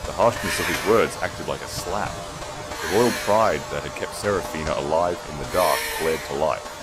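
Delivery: ordinary delivery, nothing marked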